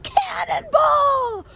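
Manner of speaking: long, high, loud mock yell; drawn-out, full-volume imitation holler; imitated scream. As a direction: yell it out like a kid leaping into a pool. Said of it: "he screams and he yells out".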